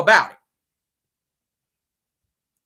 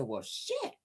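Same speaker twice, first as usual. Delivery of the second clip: mock dismay; 9 semitones higher than usual. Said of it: "I said".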